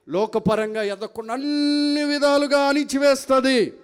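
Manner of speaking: loud, high, stretched-out proclamation; preaching climax. Real, not acted. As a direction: build to a forceful shout. Preacher's climax on being crushed. (ఆవేశంగా, గట్టిగా సాగదీస్తూ)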